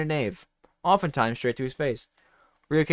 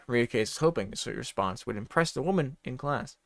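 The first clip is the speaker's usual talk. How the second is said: ordinary delivery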